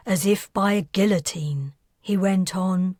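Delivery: plain reading voice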